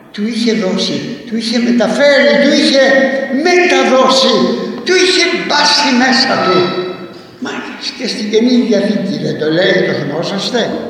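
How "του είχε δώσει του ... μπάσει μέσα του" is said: rising from plain to loud and high; building emphasis. Real, not acted. Preacher to congregation, building to a loud climax. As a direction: hammer each repetition harder.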